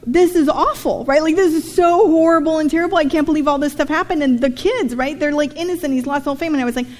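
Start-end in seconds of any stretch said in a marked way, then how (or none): 0.0-4.2 put-on higher, louder child's dismay; mock a shocked little girl; lightly voiced upset little girl. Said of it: "so this little girl goes".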